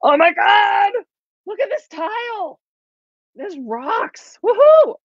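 very high, giddy squealing; thrilled squeal. Real, not acted. Presenter shows viewers the tile paper, ecstatic squeal.

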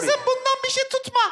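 put-on high old woman voice. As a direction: call out like a granny. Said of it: "yaşlı kadın sesi yapsın".

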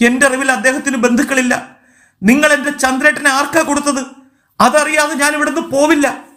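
high-pitched, distraught protest; tearful outburst. A narrator's reading. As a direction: plead and refuse, close to breaking down. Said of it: "അവൾ പൊട്ടിക്കരഞ്ഞു".

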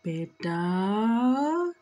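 drawn-out call sliding up from low; channeled father calling. Acted. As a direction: call out to a daughter, stretching the word upward. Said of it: "He's like, "Honey," to his daughter".

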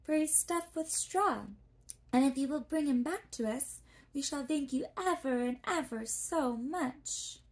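put-on a polite girl introducing herself; young girl Dorothy. A narrator's reading.

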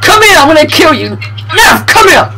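outraged gamer yelling at Nev; real furious yelling. Real, not acted. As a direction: scream his name to stop him. Friend wrecking his car in game.

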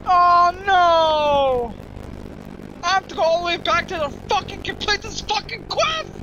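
high, loud, fast ranting in real anger; furious outburst. Real, not acted. Streamer raging at having to walk all the way back, high furious rant. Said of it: "I'm so upset right now".

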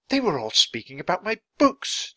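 high-pitched, wondering amazement; filled with wonder. A narrator's reading. Charles tells of his books being spoken of, full of wonder. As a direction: marvel at it, voice lifted high. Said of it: "in a voice filled with wonder".

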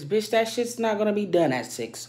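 loud, high exasperated outburst; angry rant. Real, not acted. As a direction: yell out the frustration. Ranting about early tray request.

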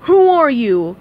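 loud royal demand; King Neptune demanding. A narrator's reading. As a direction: boom the question at an underling. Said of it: "King Neptune demanded".

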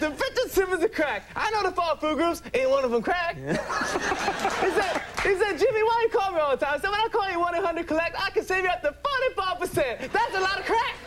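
very high, strident comedian voice; hammed-up high-pitched comic impression; Chris Rock. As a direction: push it high and punchy, stand-up style. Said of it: "have to talk like Chris Rock".

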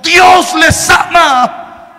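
slow, pleading call to the youths; exhorting preacher. Retold or imitated. Preacher to the young men, a slow pleading exhortation. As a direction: urge them not to waste their lives.

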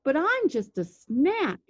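high, slow goat plea; drawn-out, high-pitched goat voice; middle-sized billy goat. A narrator's reading. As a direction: plead as a storybook goat. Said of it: "said the billy goat".

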